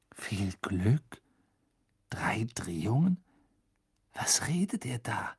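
soft, questioning repetition with rising ends; baffled echo. A narrator's reading. Boy echoing the headmaster's puzzling words, baffled. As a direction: puzzle over the words under the breath.

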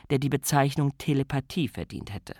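ordinary delivery

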